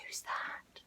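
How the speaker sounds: very soft breathless whisper; scared whisper. Acted. The cat spots a stranger in the dark, scared whisper. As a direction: gasp it in fright. Cat's frightened thought.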